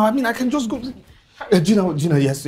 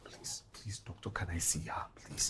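much softer, lower, desperate whisper; pleading whisper; 11 semitones lower, 15 dB quieter than usual. Acted. Husband begging doctor to see his wife, whispered plea. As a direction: beg the doctor under your breath.

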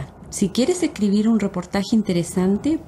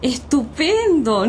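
excited; 6 semitones higher than usual. Acted. Delighted to share the flight.

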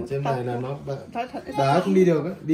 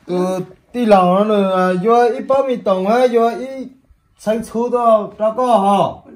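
loud and high, raised voice; to someone hard of hearing; 7 semitones higher, 5 dB louder than usual. Real, not acted. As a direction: speak up loudly for a deaf listener. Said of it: "Sao em nói, nói to đấy?".

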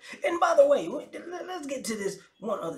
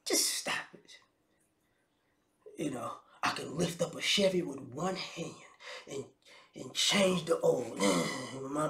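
mock hushed whisper; 5 semitones lower, 5 dB quieter than usual. Mock tough-guy boast, hushed.

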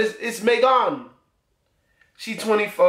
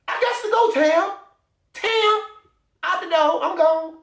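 much higher, teasing sing-song send-off; high singsong goodbye; 10 semitones higher than usual. Real, not acted. Waving off a Tinder match in a high singsong. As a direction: chirp her out the door playfully. Swiping away from Tam.

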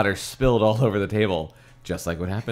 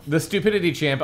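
moderately high, less forceful retort; toned-down retort; 8 semitones higher, faster than usual. A narrator's reading. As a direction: repeat it raised but not squawked. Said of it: "I said as I almost".

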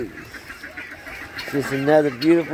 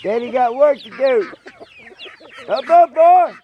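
calling from far away. Real, not acted. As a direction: shout for the dog to come. Calling dog Shane from afar.

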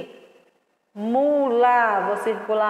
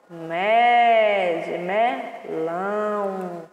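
slow syllable stress; 2 semitones lower, much slower than usual. Shown as a demonstration. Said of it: "silabando na correção".

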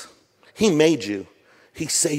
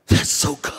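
hushed awe. Retold or imitated. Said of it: "They were like".

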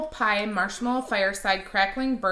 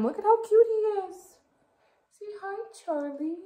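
much higher, cooing pet voice; 12 semitones higher than usual. Real, not acted. Talking to her dog.

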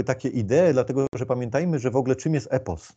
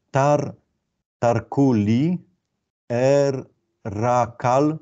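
very slow, stretched syllables, slightly raised; 2 semitones higher, 3 dB louder, much slower than usual. Shown as a demonstration. Lecturer reading Akkadian signs aloud to students, syllable by syllable. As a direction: sound out "tarkuli er rakal" in separate drawn-out pieces.

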